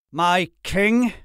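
high, indignant disbelief; disbelieving. A narrator's reading. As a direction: echo it as if you can't believe it. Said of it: "as if he could not believe".

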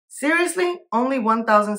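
high disbelieving Seriously; incredulous. Real, not acted. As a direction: shoot way up in disbelief. Exasperated by search results.

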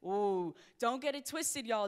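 ordinary talk

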